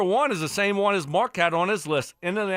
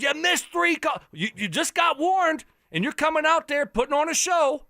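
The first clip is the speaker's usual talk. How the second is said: raised, fired-up tirade at the coach; heated rant; 8 semitones higher than usual. Real, not acted. Sports host ranting at a college coach, high and heated. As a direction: lay into him, getting hotter.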